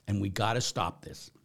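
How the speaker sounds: usual speaking voice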